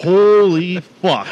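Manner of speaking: slow, high, loud awestruck cry; terrified. Retold or imitated. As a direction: drag it out in shock. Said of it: "I was like".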